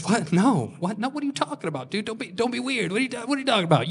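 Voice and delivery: high, hushed, flustered protest; acted incredulous denial; defensive denial. Acted defensive denial at the hot tub.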